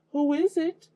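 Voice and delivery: high crackly wolf faking granny; wolf in bed pretending to be Grandma, high and crackly. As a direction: call out in a creaky old-lady falsetto. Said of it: "he called in a crackly voice".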